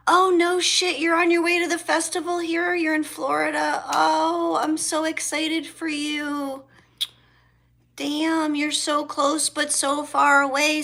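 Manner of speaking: high, loud, quick excitement; thrilled for a friend, burst out; excited. Real, not acted. Excited about a friend heading to a festival, loud and high.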